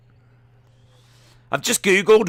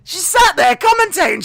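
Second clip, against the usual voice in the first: laughing pity; 5 dB louder than usual. Real, not acted.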